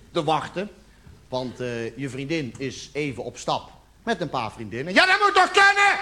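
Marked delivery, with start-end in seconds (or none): 4.9-6.0 high, loud burst; sudden high-pitched outburst; mock-cheerful exclamation. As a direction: blurt it out high and overdone. Mock-generous boyfriend in the bit.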